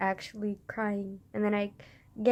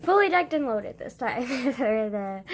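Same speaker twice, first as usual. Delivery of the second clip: normal talking voice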